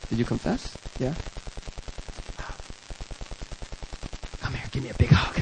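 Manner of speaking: low, soft, tender invitation; gentle mentor. Acted. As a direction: forgive him in a hushed voice. Mentor gently welcomes after confession.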